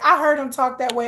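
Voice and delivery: ordinary talk, nothing marked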